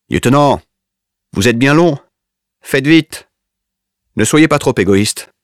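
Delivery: high, loud impatient yell through a door; shouting. A narrator's reading. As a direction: yell at him to hurry. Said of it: "Hayashi crie".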